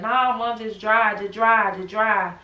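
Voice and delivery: loud, insistent repeating; pushing through pain, loud and pressing; urgent plea. Retold or imitated. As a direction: beg her to keep driving. Frantic retold plea in labor.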